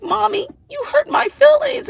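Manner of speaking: put-on high, hurt little-girl voice; daughter's voice. Mother imitating her daughter complaining, in a high child voice. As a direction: squeak it out like a wounded child.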